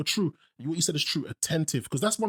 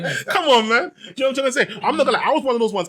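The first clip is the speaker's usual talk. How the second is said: laughing; 9 semitones higher, 9 dB louder than usual. Real, not acted. Laughing confession.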